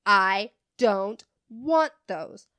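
loud, slow, word by word; imitating an insistent, irate customer; angry customer demand. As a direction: hammer out each word at half speed. Retold customer's angry demand at counter.

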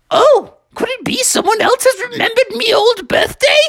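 gushing frog host; delighted old frog. Acted. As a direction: welcome a surprise guest eagerly.

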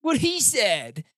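high, slow dopey voice; dim crony. Acted. Crony backs up Nelson, high and slow. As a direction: drawl it like a dumb sidekick. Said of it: "his two cronies are like".

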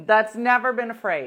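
loud, insistent objection; heated protest. Real, not acted. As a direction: push back hard. Heated argument with friend.

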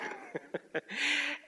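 high burst of laughter. Real, not acted. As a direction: break into a laugh. Pastor laughing at his own story.

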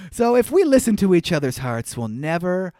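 ordinary talk

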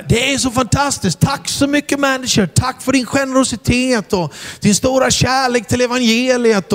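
high, bright-voiced gushing praise; effusive thanks. Preacher thanking the manager onstage, gushing. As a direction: pour on the thanks for show. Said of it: "och så sa jag".

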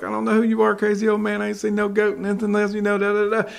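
mock the old man like a cheeky boy; sassy boy. Boy brushes off farmer.

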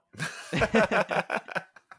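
long, high giggling fit; real laughter. Real, not acted. Both laugh after a pause.

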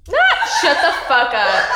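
laughing disbelief. Real, not acted. Laughing at friend's story.

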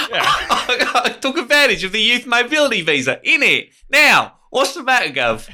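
a Cockney geezer asking what the problem is; Cockney accent. Pretend. Cockney tax office worker mocking the caller, Cockney accent. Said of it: "that's Cockney".

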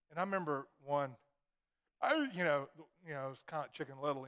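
ordinary delivery

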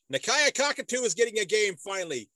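high, excited announcement; excited reveal. Real, not acted. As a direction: burst out with the big reveal. Reveals the surprise name.

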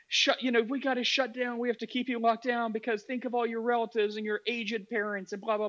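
high-pitched, pleading imitation of a politician; mock empathetic plea. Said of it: "appealing with empathy and being like".

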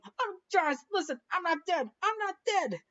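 high frantic voice, pleading; panicked Victor. Retold or imitated. Retelling Victor freaking out in the bar, high and frantic. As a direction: squeak it out like a terrified groom.

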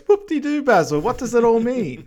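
high, loud, exaggerated singsong; Austin Powers, mock-dismissive. Imitating Austin answering Basil's lab report, mock-dismissive. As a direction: swoop up in hammed-up mock excitement.

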